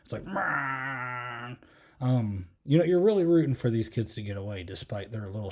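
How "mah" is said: a blaring train-horn noise; mock train horn noise; imitated train horn. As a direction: blast it like a passing train. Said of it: "it sounds like a freaking train".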